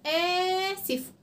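"آسف" with a long held آ and short ending; elongated first vowel. Shown as a demonstration. Teacher showing where she lengthened, opening vowel drawn out. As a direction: hold the "آ" of "آسف", then finish quickly. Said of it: "أنا طولت فين؟".

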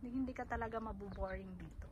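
ordinary talk

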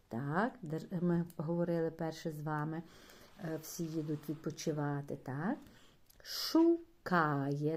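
ordinary talk

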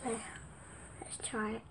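soft unvoiced whisper. Real, not acted. Girl whispering to herself before trying blackcurrant chewit, nervous whisper. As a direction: whisper it, bracing yourself.

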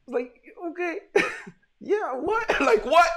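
can't believe it, squeal it half-laughing; stunned disbelief. Real, not acted. Stunned by ten-second solve.

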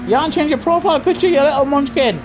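fast, high teasing voice; teasing jibe. Real, not acted. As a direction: poke fun at the friend. Teasing a friend about picture.

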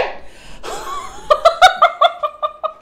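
long, high helpless laughter; high elated laugh. Real, not acted. Overjoyed laughter after screaming.

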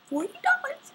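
very high squeal of disbelief on dollars. Real, not acted. Vlogger repeats the price to viewers, squealing disbelief. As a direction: shriek it like you still can't believe it. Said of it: "Oh my God".

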